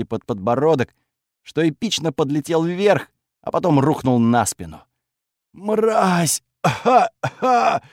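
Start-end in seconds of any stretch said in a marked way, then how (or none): 5.5-7.8 much higher, coughing out an insult; coughing thug. Acted. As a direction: spit it out while coughing. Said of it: "прокашлял он".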